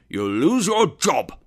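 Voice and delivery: loud, high, emphatic warning; angry threat. A narrator's reading. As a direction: drive the threat home loudly. Scrooge threatens the clerk.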